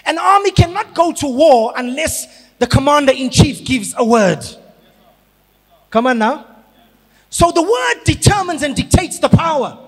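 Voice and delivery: fiery sermon peak; preaching at full force. Acted. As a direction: drive it home to the congregation.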